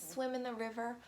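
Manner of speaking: plain reading voice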